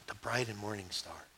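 low, very soft reply; hushed solemn answer. Acted. Believer answering a question about star signs, low and hushed. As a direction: drop right down and answer quietly.

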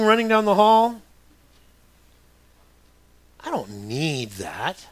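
ordinary delivery

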